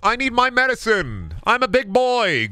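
high petulant put-on demand; whining sick boy. Sick boy demanding his medicine, in a high whiny voice. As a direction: pitch up like a demanding child.

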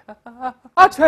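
ah, ah, ah-choo, building to a loud burst; acted sneeze. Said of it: "老外别人打了一个喷嚏".

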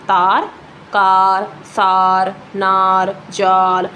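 each word apart on an even tone, long "aa" as in ਤਾਰ; example word drill. Shown as a demonstration. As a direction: stretch the aa in ਤਾਰ, ਕਾਰ, ਸਾਰ, ਨਾਰ, ਜਾਲ, one by one. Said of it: "ਐਵੇਂ ਕੋਈ ਵੀ ਉਦਾਹਰਣ".

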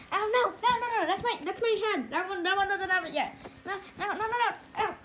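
high, loud frantic nos; panicked protest. Acted. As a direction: panic as your hand is grabbed. Character panicking over hand.

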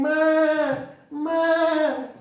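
high bleating goat voice. Acted. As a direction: bleat like the goats. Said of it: "they're saying".

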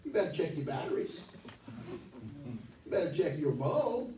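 normal talking voice

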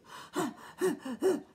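a high gasp for air; breathless gasp. Acted. Cookie gasps after running, breathless. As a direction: gasp after the long run. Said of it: "said Piggy out of breath".

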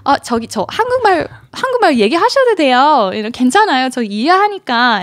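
very fast, polite Korean reassurance; rapid polite Korean. Retold or imitated. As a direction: rattle off the Korean to prove she speaks it. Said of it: "I was like".

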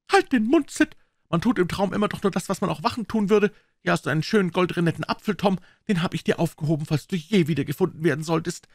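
put-on high, brisk old aunt; pious aunt, bustling and grateful; Aunt Polly. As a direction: snap at Sid then thank God. Aunt Polly scolds and prays.

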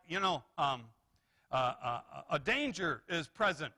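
normal talking voice

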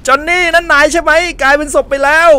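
high startled shout at a corpse; shocked cry. Real, not acted. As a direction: yelp out in shock. Finds friend Johnny dead. (ตะโกนตกใจเสียงสูง)

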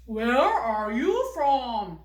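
put-on slow, deliberate model sentence for kids; 천천히 또박또박. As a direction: say each word slowly and loudly, like a teacher model. 탐 역할로 질문. (아이들에게 천천히 또박또박 들려주는 탐 목소리)

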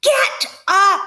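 a very high, loud scream. Acted. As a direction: scream the order at the lazy rat. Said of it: "screamed Wilbur".

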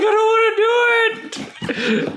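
high, slow whiny puppy voice; dog's inner voice. Pretend. Owner voicing her scared puppy, high and slow whine. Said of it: "He's like".

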